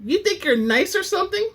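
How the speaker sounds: high, mocking put-down; sassy challenge. Woman in line scolds Lucien, sassy and high. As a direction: throw the line with attitude. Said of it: "whining her neck".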